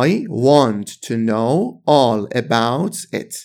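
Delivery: slow, every word separate in "I want to know all about it"; slow careful reading. Shown as a demonstration. Teacher models the full slow form for students, slow and careful. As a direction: spell it out slowly for learners, want to fully said.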